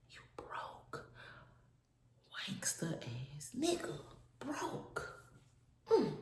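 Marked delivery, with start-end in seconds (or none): 0.1-5.0 soft unvoiced mocking insults; whispered taunts. Whispering insults at the broke ex, mocking hush. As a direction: hiss the insults under breath.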